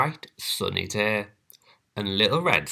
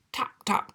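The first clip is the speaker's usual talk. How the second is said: tapping sound; 14 semitones higher, 4 dB quieter than usual. A narrator's reading. Knock sound effect.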